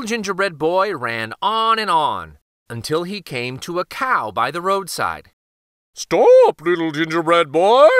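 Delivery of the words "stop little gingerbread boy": loud, voiced cow call; cow calling stop. A narrator's reading. Cow calling after the runaway, loud bellow. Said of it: "said the cow".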